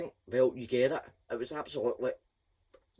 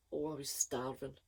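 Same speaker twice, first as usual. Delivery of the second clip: plain reading voice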